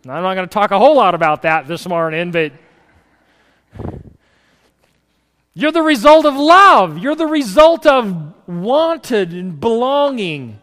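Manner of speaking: loud, rising sermon climax; preaching at full force. Acted. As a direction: swell into a big, fervent preacher's call.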